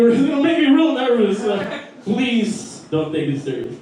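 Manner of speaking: ordinary talk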